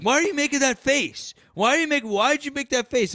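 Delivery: high, loud whiny accuser; whiny critic impression. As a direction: mimic them high and loud. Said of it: "they're like".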